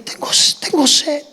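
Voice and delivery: susurro ronco y seco; suéltalo casi sin voz, reseco; con la boca seca. Acted. (susurrado y seco, con la garganta seca)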